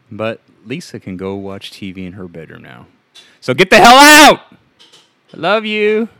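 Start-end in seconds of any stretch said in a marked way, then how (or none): 3.4-4.4 sudden high-pitched yell into the mic. Acted. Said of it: "Thanks for yelling right into the mic". 5.3-6.1 high, loud call right after a yell; really loud. Acted. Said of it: "That was really loud".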